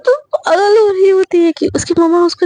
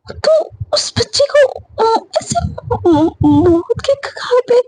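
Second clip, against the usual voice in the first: higher, cracking, tearful scolding; voice breaking; 5 semitones higher, slower than usual. Retold or imitated. Mother scolding her daughter, voice cracking with tears. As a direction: scold her while the tears rise into your voice.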